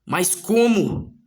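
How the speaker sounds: high, drawn-out disbelieving question; acted bafflement. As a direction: play a stunned hero, stretching the words. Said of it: "E o Kako se pergunta".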